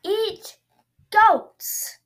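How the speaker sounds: high, very loud shriek; monster shriek. Acted. Tentacle monster demanding goats, shrieking. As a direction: screech it like a hungry beast. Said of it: "the monster shrieked".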